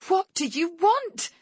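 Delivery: a high, loud, slow shriek; terrified mother shrieks at her captors. Acted. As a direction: scream the question at them. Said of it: "I shriek".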